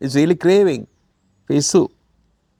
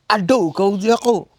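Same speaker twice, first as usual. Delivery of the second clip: angry outburst; 5 semitones higher than usual. Retold or imitated. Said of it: "Losing temper means".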